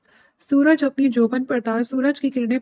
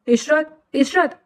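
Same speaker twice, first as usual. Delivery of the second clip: higher calling out of a name; shouting her name; 4 semitones higher, much slower than usual. A narrator's reading. Husband shouting for his wife on finding the room empty, raised call. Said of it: "عمر لشاری چلاتے ہوئے".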